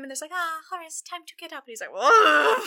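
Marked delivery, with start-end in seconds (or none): 0.3-1.7 high, soft coaxing voice; hushed sing-out to a sleeper; maids' gentle wake-up. Retold or imitated. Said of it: "maids have woken him". 1.9-2.6 mock startled whoa, pitched up; startled awake. Acts hungover man startled awake.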